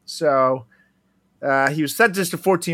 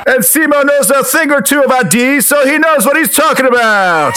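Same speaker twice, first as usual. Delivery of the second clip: upbeat sponsor read, sports-announcer energy; 14 semitones higher, 12 dB louder than usual. Acted. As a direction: sell it like a game-day hype reel.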